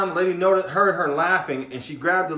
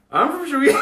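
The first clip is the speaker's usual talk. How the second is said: higher, laughing "I'm from Sweden"; fake Swedish claim; 8 semitones higher than usual. Guy bluffing bouncer at club, laughing fake Swede. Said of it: "I said".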